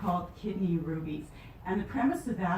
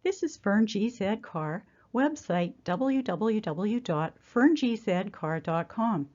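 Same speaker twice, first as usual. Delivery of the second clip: louder, carefully read voiceover; scripted welcome read out loud; recorded introduction; 7 dB louder than usual. Acted. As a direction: present the series to viewers, projecting clearly.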